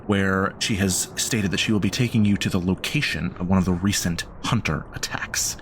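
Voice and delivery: steady storytelling recap voice; recap narration. Acted. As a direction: set the scene like a show intro. Game master recap narration.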